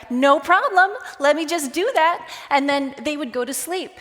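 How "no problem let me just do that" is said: bright, eager and higher; mock-cheerful people pleaser; eager yes-man. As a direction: chirp it like nothing's too much. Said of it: "And I would be like".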